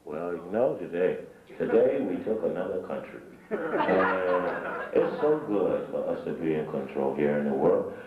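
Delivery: very low, slow, smug elite drawl with a chuckle; a powerful man talking low and pleased with himself; mock David Rockefeller. Mocking how Rockefeller speaks to the crowd, very low and slow. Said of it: "You ever seen David Rockefeller and them speak?".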